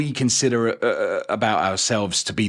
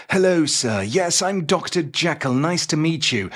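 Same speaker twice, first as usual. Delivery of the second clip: higher, polite gentleman voice; mock audiobook gentleman, pitched up; Doctor Jekyll; 5 semitones higher than usual. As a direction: greet politely in a high, proper voice. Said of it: "It was kinda like".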